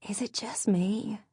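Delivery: unvoiced whisper into a friend's ear; hushed conspiratorial whisper. A narrator's reading. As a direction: whisper it so the man can't hear. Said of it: "Maddie whispered into Lainey's ear".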